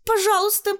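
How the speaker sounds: put-on very high, airy-headed consent from a girl; cheeky high-pitched girl; granting permission. A narrator's reading. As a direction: pipe it up high, as if graciously allowing it. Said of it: "разрешила Таня".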